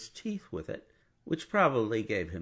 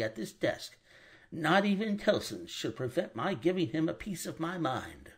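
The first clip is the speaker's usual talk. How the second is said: a touch of suppressed indignation, higher; restrained anger; 7 semitones higher than usual. Acted. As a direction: hold the anger down but firm.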